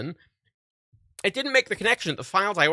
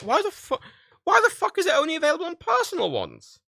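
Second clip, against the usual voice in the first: retold exasperated rant; 13 semitones higher than usual. Annoyed at Dropbox support.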